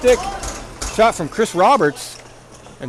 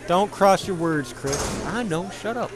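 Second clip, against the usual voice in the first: muttered self-scolding and reply, much softer; talking to himself; 7 dB quieter than usual. Pretend. Commentator scolding himself and answering, quiet muttering. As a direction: drop the volume for a private back-and-forth. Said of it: "I just talked to myself and responded".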